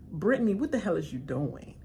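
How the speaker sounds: ordinary talk, nothing marked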